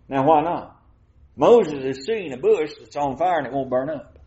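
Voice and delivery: usual speaking voice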